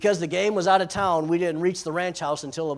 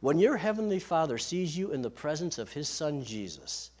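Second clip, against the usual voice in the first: normal talking voice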